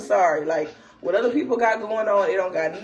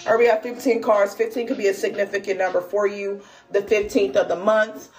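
normal talking voice

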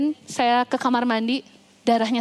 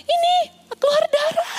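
very high, frightened wail; acted high-pitched alarm; re-enacted panicked cry. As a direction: cry out the bad news in panic. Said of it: "saya teriak sama suami saya".

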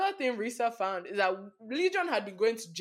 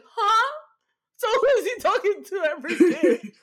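much higher and louder, laughing; laughing loud disbelief; incredulous outburst; 13 semitones higher, 12 dB louder than usual. Real, not acted. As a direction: ask it in utter disbelief. Disbelief at liar's story.

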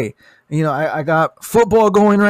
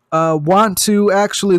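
usual speaking voice

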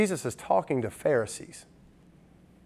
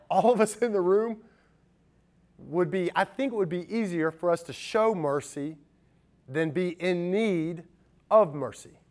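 usual speaking voice

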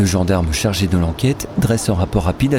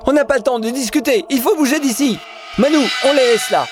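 much higher, urgent yelling; panicked shouting. Acted. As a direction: shout them into leaving now. Panicked escape after fatal crash. (cris paniqués et pressants)

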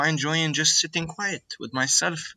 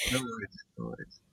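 soft trailing murmur to herself; quiet murmur; 3 semitones lower, 12 dB quieter than usual. Real, not acted. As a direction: let the words fade almost to nothing. Repeats the phrase softly to herself.

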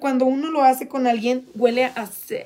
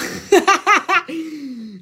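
open, high-pitched cackle; real laugh; 4 semitones higher than usual. Real, not acted. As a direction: burst out laughing. Laughs at the off-camera question.

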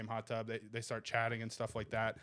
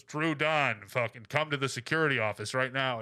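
louder announcer voice over the ship speakers; mock intercom announcement; 5 dB louder than usual. Cruise ship intercom calling him to security, mock announcer. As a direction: boom it like the ship's PA.

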